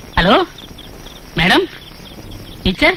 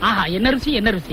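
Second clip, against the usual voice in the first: savouring murmur; 5 semitones lower, 7 dB quieter than usual. Acted. Savouring food alone.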